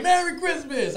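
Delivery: high, laughing holiday shout; gleeful mock greeting; mock festive cheer. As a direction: call it out high through laughter. Retells gleeful toss off balcony.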